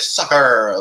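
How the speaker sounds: stretched American-style soccer; mock American accent. Explaining what Americans call football, mock American accent. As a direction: imitate Americans saying soccer, hammed-up. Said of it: "my American audience".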